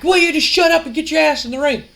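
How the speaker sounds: high-pitched exasperated demand; frustrated. Retold or imitated. Frustrated wrestler orders his rival into the ring, exasperated. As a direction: imitate an impatient rival losing patience. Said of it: "getting frustrated".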